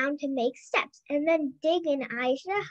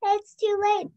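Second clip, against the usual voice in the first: shout; 6 semitones higher, slower than usual. A narrator's reading. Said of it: "shouted Leo".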